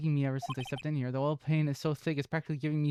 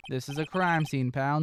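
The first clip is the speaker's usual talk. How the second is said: normal talking voice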